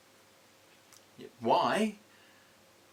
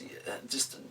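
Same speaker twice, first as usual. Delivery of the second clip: whisper; 12 dB quieter than usual. Real, not acted. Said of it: "muttered aside".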